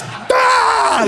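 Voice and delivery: very high, drawn-out scream; mock group scream. Teller imitates teammates falling off chairs, high screaming howl. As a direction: imitate a crowd of lads going wild. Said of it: "And the lads are like this".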